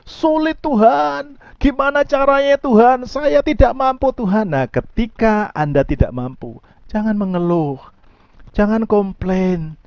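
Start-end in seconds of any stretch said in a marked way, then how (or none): 0.0-4.5 high, drawn-out cry of "I can't cope"; acted helpless lament to God; overwhelmed plea. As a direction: plead upward as if out of your depth. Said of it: "Anda merasa".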